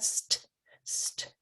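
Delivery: unvoiced st- repeated; voiceless "st-" tapped twice; whispered blend sounds. Shown as a demonstration. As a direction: breathe out "st-, st-" with no voice. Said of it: "your tongue is tapping".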